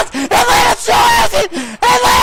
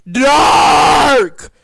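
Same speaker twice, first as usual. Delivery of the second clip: ordinary delivery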